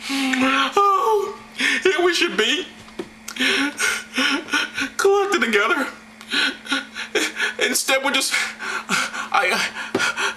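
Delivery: high, halting fake sobs mixed with laughter; laughing and pretend-crying between phrases; mock upset. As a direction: struggle through it as if weeping. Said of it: "I'm just so upset about".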